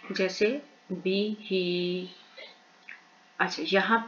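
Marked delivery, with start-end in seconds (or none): 0.1-2.1 ही drawn out one alif long, slowly; lengthened vowel. Shown as a demonstration. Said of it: "एक अलिफ तक लंबा करके इसको पढ़ा जाता है". ("ही" को एक अलिफ लंबा खींचकर)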